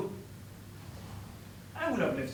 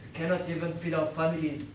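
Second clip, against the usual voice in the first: ordinary talk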